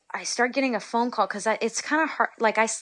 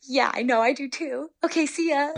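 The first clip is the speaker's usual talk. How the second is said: higher, louder, giddy goodbye; 7 semitones higher, 3 dB louder than usual. Retold or imitated. Player ending the call with the coach, giddy and higher. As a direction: bubble over as you hang up.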